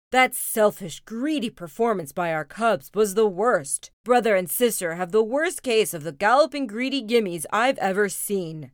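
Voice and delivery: loud, punched indignant rant; angry Papa roar. Acted. Papa ranting to Mama about the cubs, loud roar. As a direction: rail loudly, hitting each key word. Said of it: "he roared".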